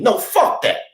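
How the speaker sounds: normal talking voice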